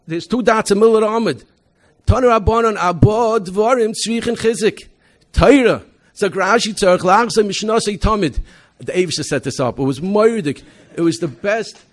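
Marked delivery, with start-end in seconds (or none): 0.1-8.4 loud, high, excited recitation of the Gemara; retold scream. Student on speakerphone reciting the Gemara by heart, loud and high. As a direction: belt out the text proudly like he knows it cold. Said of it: "he screamed on top of his lungs".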